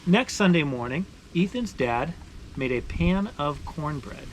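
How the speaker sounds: steady, expressive read-aloud voice; story narration. Acted. Reading a maple sugaring picture book aloud to viewers, storytelling voice. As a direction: tell it like a picture book for kids.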